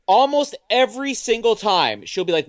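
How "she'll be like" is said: ordinary talk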